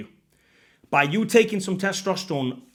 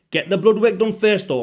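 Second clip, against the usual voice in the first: louder, higher insistence on the blood work; emphatic warning; 6 semitones higher, 7 dB louder than usual. Real, not acted. Urging viewers to test blood first, loud and emphatic. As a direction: hammer home the warning to viewers. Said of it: "I'm always gonna emphasize that".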